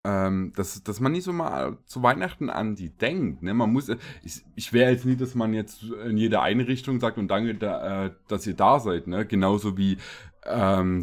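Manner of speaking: normal talking voice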